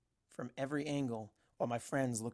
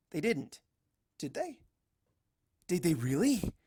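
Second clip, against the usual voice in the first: rising, hopeful questions; can it really be true; hopeful disbelief; 5 semitones higher, 4 dB louder than usual. Acted. Twilight hoping, disbelieving.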